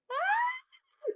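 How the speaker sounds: a sudden high frustrated shriek. Real, not acted. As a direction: let out a sharp exasperated scream. Frustrated outburst mid-rant.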